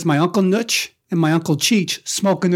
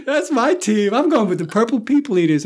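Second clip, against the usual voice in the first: put-on much higher, thrilled "Oh, yeah, baby."; light up as you pick your team; excited kid; 6 semitones higher than usual. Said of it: "And I was like".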